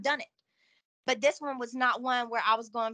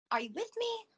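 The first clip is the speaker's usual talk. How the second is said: mildly alarmed; 11 semitones higher than usual. Retold or imitated. Woman to unresponsive partner, retold anxious check. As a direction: retell a worried, rising plea for a response.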